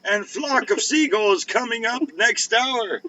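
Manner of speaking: high, slow oldies radio jock. Pretend. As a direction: announce the lineup like an old DJ. Parody oldies station DJ.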